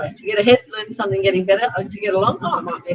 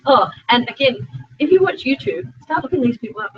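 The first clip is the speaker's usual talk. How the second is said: ordinary talk, nothing marked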